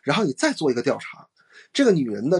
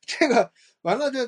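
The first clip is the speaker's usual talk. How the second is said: laughing; 9 semitones higher than usual. Real, not acted. Laughing mid-story.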